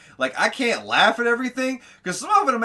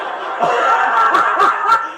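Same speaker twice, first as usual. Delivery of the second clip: laughing loudly; 3 semitones higher, 8 dB louder, much slower than usual. Real, not acted. Host cracking up at the story.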